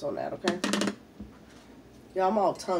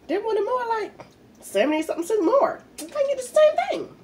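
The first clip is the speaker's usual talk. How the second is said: indignant outburst; 6 dB louder than usual. Real, not acted. Complaining about brand price.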